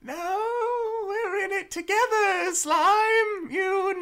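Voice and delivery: high, rallying character voice, rising to a shout on Slime. Acted. Doc rallying a frightened Slime, high and bright. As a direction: cheer a scared friend in a high voice.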